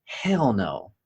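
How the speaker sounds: ordinary delivery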